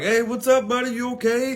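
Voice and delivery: very high-pitched, jokey greeting; amused cops. Retold or imitated. Laughing cops greeting the drunk caller, mock amused concern. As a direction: imitate an amused officer checking in. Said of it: "they're laughing".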